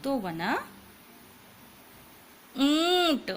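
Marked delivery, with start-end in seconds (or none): 2.5-3.3 ऊंट with a long nasal ऊँ, louder. Shown as a demonstration. Teacher announces the word ऊंट to the class. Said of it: "ऊ में ट मिला दिया".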